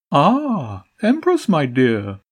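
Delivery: high, jolly pigeon greeting; a sly bird host, all bonhomie. A narrator's reading. Pigeon boss greeting the Empress, high and jolly. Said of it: "a high, almost jolly-sounding male voice".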